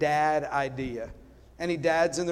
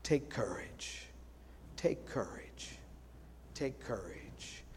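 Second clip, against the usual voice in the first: very soft, low, slow whisper; whispered take courage; 6 semitones lower, 17 dB quieter, slower than usual. Shown as a demonstration. Pastor showing how to whisper courage, very soft and slow. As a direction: hush it to the congregation, slowly. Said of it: "try to whisper that".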